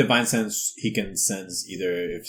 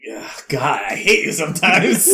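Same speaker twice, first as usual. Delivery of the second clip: exasperated laughing groan; 7 semitones higher, 9 dB louder than usual. Real, not acted. Reacts to pun.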